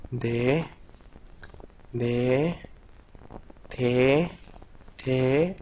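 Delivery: "D" and "T" as separate drawn-out syllables; memorization drill. Shown as a demonstration. Teacher helps students remember, "D" and "T" drilled one at a time. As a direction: repeat "D", then "T", with pauses between. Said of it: "Así para que se recuerden".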